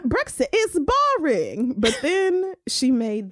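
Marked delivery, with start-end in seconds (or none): 0.0-1.7 high-pitched start in a put-on British accent; hammed-up mock British. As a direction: imitate a bad fake London accent. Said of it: "got away with that accent".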